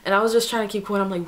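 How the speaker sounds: ordinary talk, nothing marked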